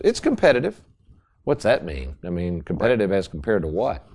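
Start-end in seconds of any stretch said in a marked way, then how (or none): 0.1-0.8 high-pitched dismissive salesman line; mock salesman. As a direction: toss it off like a slick dealer. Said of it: "or they'll say this".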